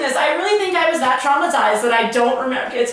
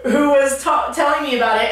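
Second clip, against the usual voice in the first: ordinary talk, nothing marked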